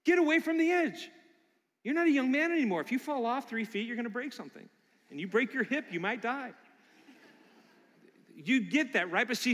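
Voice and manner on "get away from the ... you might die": high, loud mock alarm from the crowd; worried congregation. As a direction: play the fussing flock scolding their pastor. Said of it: "all of you are going".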